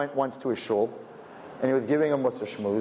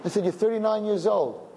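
blunt retold put-down; 8 semitones higher than usual.

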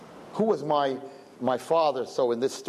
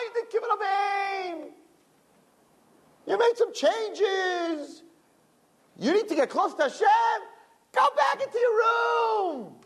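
the guf's voice; slower than usual. Retold or imitated. The body voice talking the girl out of growing, high and coaxing. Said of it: "says the guf".

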